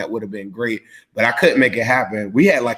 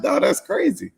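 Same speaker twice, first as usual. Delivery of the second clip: very high, squeaky disbelief; 14 semitones higher than usual. Retold or imitated. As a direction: pitch it way up in disbelief. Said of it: "I was like".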